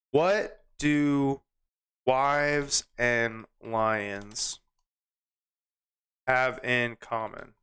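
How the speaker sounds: very slow, with gaps between words; typing aloud. Real, not acted. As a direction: say it word by word as you type. Types the question while saying it.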